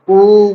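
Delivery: उ drawn out very long; prolonged vowel. Shown as a demonstration. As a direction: hold उ as an extended oooo. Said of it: "प्लुत को लगता है".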